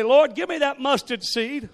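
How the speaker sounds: fervent prayer. Shown as a demonstration. Congregation praying aloud, a loud earnest plea. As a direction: ask for it with all your heart.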